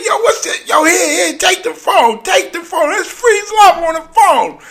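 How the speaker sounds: high frantic yelling, words tumbling out; wild excited shouting. Retold or imitated. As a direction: shout like a drunk friend in a club. Imitating Tracy Morgan shouting excitedly.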